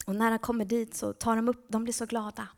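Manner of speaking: ordinary talk, nothing marked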